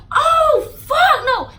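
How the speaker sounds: high, loud outraged mom shout. Retold or imitated. Mom hearing her daughter was jumped, an outraged shout. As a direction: mom blowing up in fury, high.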